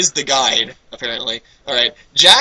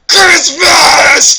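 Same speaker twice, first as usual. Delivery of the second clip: higher, louder, gleeful yell; excited shout; 10 semitones higher, 8 dB louder than usual. Acted. Jackal finds out it is Christmas, an excited shout. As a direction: yell it like a kid at dawn. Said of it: "Jackal jumped out of bed".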